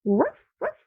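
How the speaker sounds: high soft little woofs; puppy bark. Shown as a demonstration. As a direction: yip like a small dog. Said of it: "What does the dog say?".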